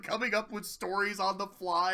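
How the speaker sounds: usual speaking voice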